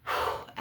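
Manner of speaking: forceful audible exhale; blowing out. Shown as a demonstration. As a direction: blow the breath out in a quick release. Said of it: "when I blow and release".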